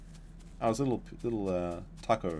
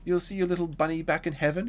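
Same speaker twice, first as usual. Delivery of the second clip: higher, mock-soothing voice; reassuring cousin; 9 semitones higher than usual. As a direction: imitate someone hurriedly comforting a kid. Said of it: "He's like".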